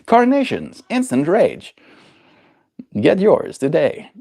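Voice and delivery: mock TV slogan, drawn out. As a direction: sell it like a cheesy commercial.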